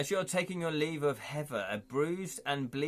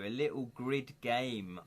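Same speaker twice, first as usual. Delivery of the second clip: ordinary talk, nothing marked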